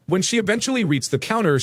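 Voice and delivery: plain reading voice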